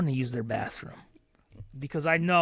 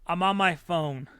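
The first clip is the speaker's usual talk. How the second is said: ordinary talk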